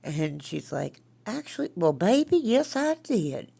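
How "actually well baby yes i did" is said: imitating granny's country accent, pitched up. Grandson asked about ghosts, granny answers in country accent. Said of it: "She has, like, a country accent".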